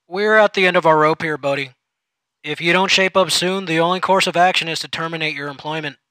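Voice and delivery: boss voice. Acted. Supervisor threatens firing.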